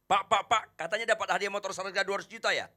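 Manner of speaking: loud, high and fast; eager customer. Acted. Customer eagerly asking the salesman about the free motorbike, loud and fast. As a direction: rush at the salesman all eager for the prize.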